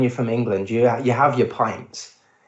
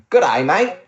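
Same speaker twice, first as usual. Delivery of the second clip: higher, louder mock Australian accent; 6 semitones higher, 5 dB louder than usual. Mock Aussie greeting to the travellers, hammed-up Australian accent. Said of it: "that's my Australian accent".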